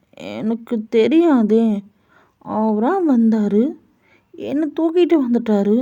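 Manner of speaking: put-on high, slow little-boy voice; innocent childlike. Jungkook telling the mother he doesn't know why he was taken, high slow childlike voice. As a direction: drag the words out innocently.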